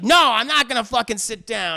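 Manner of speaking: loud, defiant mock anger; hammed-up yelled defiance; yelling refusal. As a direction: imitate an angry man refusing outright. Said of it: "you just gotta stop yelling".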